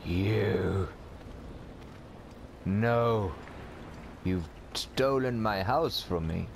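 hammed-up tragic farewell to life; mock despair. Melodramatic despair at stolen house.

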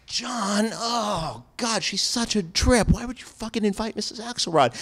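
high, whiny imitation of groaning teachers; mock exasperated colleagues. Colleagues scolding him for inviting Missus Axelrod, high mock whine. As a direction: mimic the whole table moaning at him. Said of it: "And they were all like".

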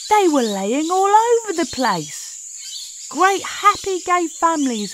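high and loud, bursting with delight; excited exclamation. Acted. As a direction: shout it like you just struck gold. Narrator spots laying birds excitedly.

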